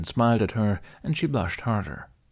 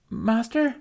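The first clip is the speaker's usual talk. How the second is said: put-on high, rising nervous child's call from far off. A narrator's reading. Youngling calls her master from across the archives, nervous. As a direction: call out anxiously after something goes wrong. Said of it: "Aola called in a nervous tone".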